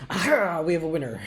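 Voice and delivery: high, loud exclamation; triumphant announcement. Acted. Guard rewarding the correct password, triumphantly. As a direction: crow it like a game host.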